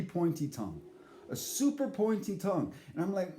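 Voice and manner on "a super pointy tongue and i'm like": normal talking voice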